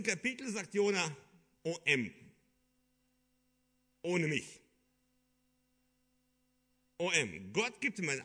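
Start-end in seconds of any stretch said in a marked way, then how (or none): none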